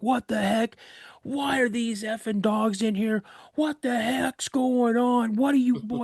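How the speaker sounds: high, outraged rant; angry cussing. Retold or imitated. Farmer finds dogs inside at dawn, high furious scolding. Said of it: "He's saying all these cuss words".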